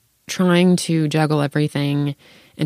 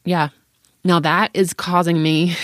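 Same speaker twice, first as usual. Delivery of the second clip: ordinary talk, nothing marked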